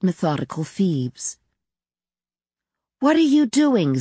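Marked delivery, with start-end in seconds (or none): 3.0-4.0 high-pitched, mildly stern question; a child's mild, high-voiced demand. A narrator's reading. Child confronting the maid at her closet, mildly stern. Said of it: "Zita asked sternly".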